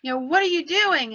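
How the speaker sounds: retold yelling. Husband bursting out at his wife, retold yelling. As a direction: imitate an upset husband confronting his wife. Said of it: "he's yelling at her".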